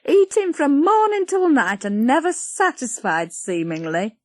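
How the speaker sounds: scolding country woman voice; Patty, nagging. Acted. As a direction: grumble about her appetite.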